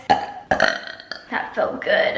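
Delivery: words pushed out on a slow burp; drawn-out burped words after a morning burp; burp talk. Real, not acted. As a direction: speak it through a belch. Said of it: "A good burp in the morning".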